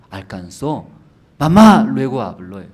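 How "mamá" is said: loud, high call of mom. Acted. Son running after his mother, calling loudly. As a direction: shout after her down the path.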